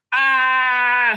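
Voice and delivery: short, high, loud cry of agony; mock scream of pain. Voicing the man being mind flayed, hammed-up scream. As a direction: yelp like the mind flayer just switched on.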